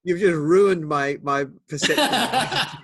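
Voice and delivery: drawn-out teasing complaint that gives way to a real laugh; amused mock protest. Real, not acted. Listener joking, breaking into laughter.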